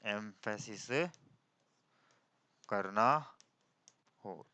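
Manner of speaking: low-key, drawn-out words with long pauses; slow, measured classroom dictation. Real, not acted. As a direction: dictate phrase by phrase for note-takers. Dictates the rule word by word.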